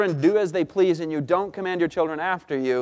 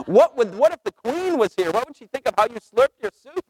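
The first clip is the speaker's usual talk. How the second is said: higher, fussy mock Canadian worry; mock fretting Canadian; 7 semitones higher than usual. Husband teasing Canadians, mock worried higher voice. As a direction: lightly send up a Canadian fretting about the Queen. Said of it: "Have you ever hung out with Canadians".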